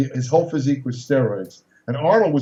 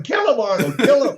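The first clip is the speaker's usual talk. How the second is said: higher-pitched mock yell; retold yelling; 9 semitones higher than usual. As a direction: imitate a bystander's yell at reduced force. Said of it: "He's just yelling".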